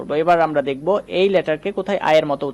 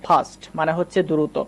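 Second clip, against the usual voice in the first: ordinary delivery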